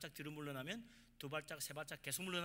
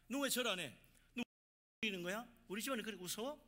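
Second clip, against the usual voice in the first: acted loud, raised-voice demand; scolding elder; 5 semitones higher, 6 dB louder than usual. Elder to relative who won't bow at the rite, indignant scolding. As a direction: confront them like an offended family elder.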